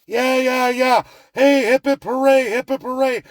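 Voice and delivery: high-pitched sarcastic hooray; loud, hammed-up cheer; mock cheering. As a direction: whoop it up in fake celebration. Sarcastic cheering of oil deal.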